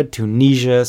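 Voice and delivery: ordinary delivery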